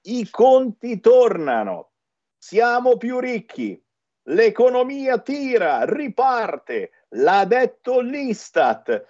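high, slow, hammed-up cheerful announcer; mock headline voice. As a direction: read the good news like a parody. Mock reading of upbeat headlines.

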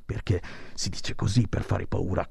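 soft unvoiced whisper. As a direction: whisper it like a secret. Confides aside, scare-mongering jab.

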